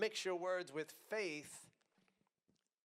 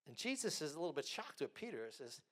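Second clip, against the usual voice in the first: usual speaking voice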